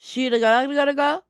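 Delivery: high, unsure go at "Shitagaraga"; hesitant gibberish attempt; trying gibberish. Shown as a demonstration. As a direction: fumble through "Shitagaraga" with a rising question. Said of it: "trying to figure out how to say my name".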